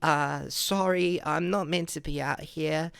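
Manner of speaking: put-on high, slow, apologetic child voice; little kid voice. Little kid apologising to a police officer, high child voice. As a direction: imitate yourself as a sheepish little kid. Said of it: "as a little kid, I was like".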